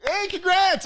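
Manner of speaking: high, loud congratulating call; hammed-up cheer over the screaming; mock congratulations. Seat neighbour congratulating the screaming woman, high and loud. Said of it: "And I go".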